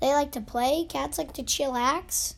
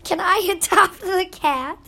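high, loud and thrilled; excited shout; 5 semitones higher, 8 dB louder than usual. Retold or imitated. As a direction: beg to adopt it in a shout. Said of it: "not the cow".

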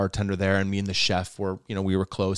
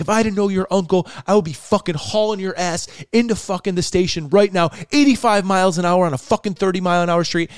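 high, loud retold tirade; imitating yelling cop; 8 semitones higher, 4 dB louder than usual. Cop berating a speeding driver he knows, mock yelling. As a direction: chew out the speeder like a furious cop. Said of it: "he's fucking yelling at me like this".

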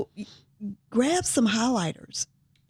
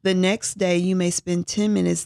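ordinary talk, nothing marked

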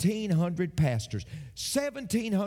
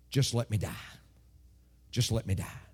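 low, weary mock-despairing prayer; despairing plea; 7 semitones lower than usual. Discouraged man praying under a broom tree, low and despairing. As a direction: plead lowly, as if giving up.

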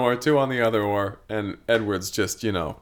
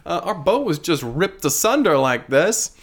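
excited passengers; 9 semitones higher than usual. Acted. Rescued passengers gushing.

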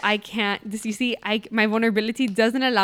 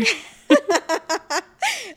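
laughing; 11 semitones higher, 6 dB louder than usual. Real, not acted. Laughing at letter.

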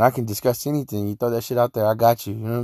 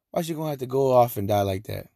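ordinary delivery

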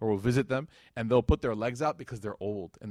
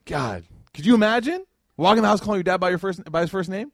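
higher, louder mock-appalled disbelief; mock horrified shudder; 6 semitones higher, 6 dB louder than usual. Speaker imitates people shocked at first-name parenting, mock horrified. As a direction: act scandalized, pitched up and loud. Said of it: "I just saw people shudder".

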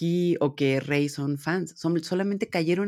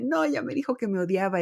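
higher, put-on whimpering complaint, hammed up; mock crying dad; 6 semitones higher than usual. Crying dad telling his partner she hates him, in a mock sob voice. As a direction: whine like a heartbroken dad. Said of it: "Kazuki se va llorando y dice".

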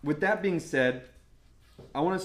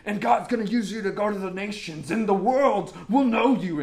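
loud, high-pitched mock prophet; booming imitation of prophecy over someone; prophetic word; 6 semitones higher, 8 dB louder than usual. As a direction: proclaim it like a fervent prophet. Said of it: "prophetic word".

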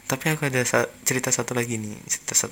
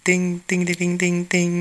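high, louder ringing tings; imitated vendor's bell; 4 semitones higher, 5 dB louder than usual. Podcaster mimics a passing food vendor, chiming imitation. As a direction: mimic a street seller's bell. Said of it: "saat ada tukang dagangan lewat".

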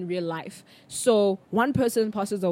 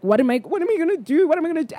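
mock panic; 7 semitones higher, faster than usual.

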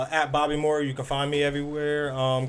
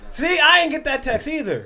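high, raised insistence; indignant protest; 11 semitones higher, 4 dB louder than usual. Real, not acted. Backing his case about the missed text, high and heated. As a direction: pounce on it to win the argument. Arguing over the missing text.

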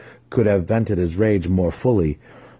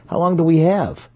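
eager; 10 semitones higher, 5 dB louder than usual. A narrator's reading. Massage eager question.

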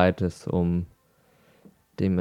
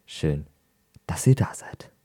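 whispering; 4 semitones lower than usual. Shown as a demonstration. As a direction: whisper it to the listeners. Same greeting whispered to all.

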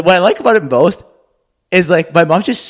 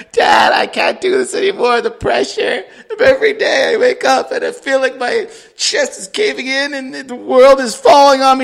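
higher, half-laughing mock wail; mock crying plea; 9 semitones higher, 3 dB louder than usual. Daughter begging her dad to quit, mock crying. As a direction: ham up a teen breaking down. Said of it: "the other one's going".

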